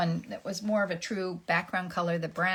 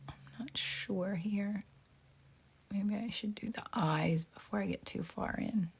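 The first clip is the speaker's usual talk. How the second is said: whispered thoughts to herself; hushed muttering while painting; whispering; 2 semitones higher, 6 dB quieter than usual. Real, not acted.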